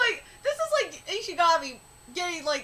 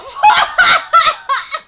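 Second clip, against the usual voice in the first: high, explosive laugh; loud laughing; 10 semitones higher, 14 dB louder than usual. Real, not acted. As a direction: crack up loudly. Laughs at the story.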